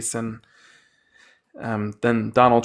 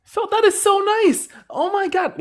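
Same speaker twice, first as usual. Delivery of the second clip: overjoyed thanks; 4 dB louder than usual. Real, not acted. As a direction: gush thanks to Phil in delight. Gift thanks, squealing high.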